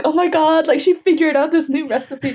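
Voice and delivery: high, laughing, starstruck gasp over grandma's recipe; mock amazement. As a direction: gush like a kid who thinks grandma is a genius. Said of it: "I'm like".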